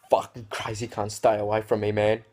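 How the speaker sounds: playful fake panic, calling out; mock scared yell. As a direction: shout it like you're fleeing a madman. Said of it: "And I go".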